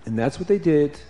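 usual speaking voice

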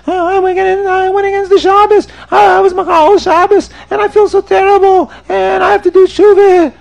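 high, loud hammed-up wail; crying like a baby. Sinner confesses to the Baal Shem Tov, mock bawling. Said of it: "crying and screaming in the shul like a baby".

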